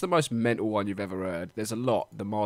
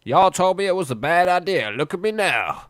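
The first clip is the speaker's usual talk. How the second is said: hammed-up American accent, pitched up and loud; 8 semitones higher, 7 dB louder than usual. Cider maker boasts to his doubters, hammed-up American accent. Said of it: "I don't know why he's American".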